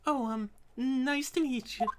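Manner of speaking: a hint of a timid girl; shy greeting. Voicing a character greeting someone, lightly timid.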